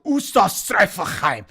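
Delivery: slow, mock-German nonsense word; fake German. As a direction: ham up a German sound. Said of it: "this German phrase that is not even German".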